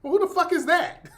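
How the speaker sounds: loud, high indignant yell; outraged. Retold or imitated. Uncle reading his bill, loud outraged yell. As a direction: demand to know who this is. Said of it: "I'm like".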